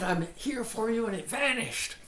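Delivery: high-pitched imitation of a thrilled student; mock amazement. Student tells teacher the problem vanished, hammed-up wonder. Voices students' delighted report back.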